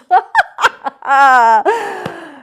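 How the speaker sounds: loud high joyous laugh; a big rusty belly of a laugh; splendid laugh. Acted. Scrooge's first laugh in years, splendid. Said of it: "it was a splendid laugh".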